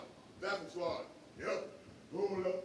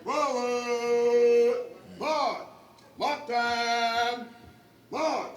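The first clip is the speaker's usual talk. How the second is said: drawn out parade commands; 3 semitones higher, 9 dB louder, much slower than usual. Real, not acted. Drill leader calling commands.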